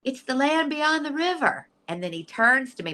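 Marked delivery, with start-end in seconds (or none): 0.0-1.7 high, awestruck voice; high-pitched, rapturous announcement; retold excited wonder. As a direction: imitate an old man marvelling at a vision. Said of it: "is so excited".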